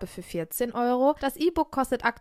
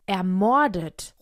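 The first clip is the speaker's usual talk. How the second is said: slow, louder exclamation; dramatic revelation; 4 semitones lower, 5 dB louder, much slower than usual. A narrator's reading. Barbara reveals the murder to her friends, drawn-out dramatic exclamation. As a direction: draw the word out for impact.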